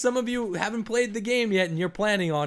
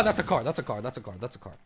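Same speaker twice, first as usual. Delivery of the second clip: sinking lower and softer each time; fading muttered repeat; 9 semitones lower, 6 dB quieter, faster than usual. Real, not acted. Gamer spotting a car, muttered repeat fading out. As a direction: mutter it away to almost nothing.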